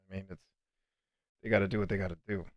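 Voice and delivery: normal talking voice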